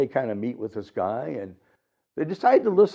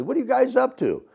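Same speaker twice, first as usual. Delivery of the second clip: hobo voice; 12 semitones higher, 4 dB louder than usual. Acted. Hobo voiced greeting boys.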